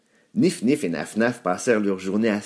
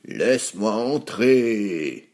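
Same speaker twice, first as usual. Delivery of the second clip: drawn-out wolf demand, falling at the end; wolf calling out; much slower than usual. A narrator's reading. Wolf at the wooden house door, crying out. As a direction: demand slowly, stretching the vowels. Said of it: "cria le loup".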